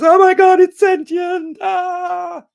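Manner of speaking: high, loud shriek of alarm; mock freak-out at full volume, ending in a scream; explosive panic. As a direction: burst out screaming in hammed-up terror. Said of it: "explosion in his energy system".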